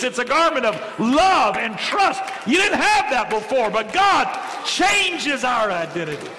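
high, rising preacher's build to a shout; soaring pulpit crescendo ending in a shout; full-force preaching. Real, not acted.

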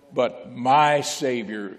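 slow, weighted "my Savior"; stress on my. Real, not acted. Preacher driving home a personal Savior. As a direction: lean hard on "my", drawn out. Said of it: "know Jesus as my Savior".

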